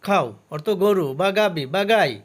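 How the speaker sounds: ordinary talk, nothing marked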